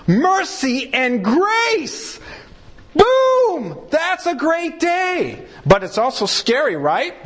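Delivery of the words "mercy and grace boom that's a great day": high, slow, thrilled cry of delight; joyful cheer. Retold or imitated. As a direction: celebrate grace like a happy believer. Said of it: "we're going".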